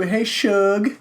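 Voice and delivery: high, loud happy hello to a pet; pet greeting. Real, not acted. Greeting the cat Shug with a high happy call.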